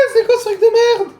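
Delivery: comic screech of revulsion; shrieked disgust. Real, not acted. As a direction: scream it at the gross pizza. Disgust at the pizza topping.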